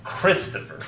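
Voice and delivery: Christopher as CHRIS-topher; stress on first syllable. Shown as a demonstration. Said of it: "sound right except the first one".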